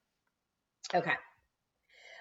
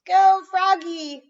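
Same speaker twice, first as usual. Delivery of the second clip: much higher, louder slow cheer; hollering; 13 semitones higher, 13 dB louder, slower than usual. A narrator's reading. Frogalina hollering to cheer Froggy on. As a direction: holler across the field.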